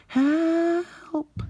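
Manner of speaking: very high, loud frightened cry; cry for help. Acted. Percy and Duncan falling in the hole, very high loud cry for help. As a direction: shriek for help from the pit. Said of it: "fell in the hole and cried".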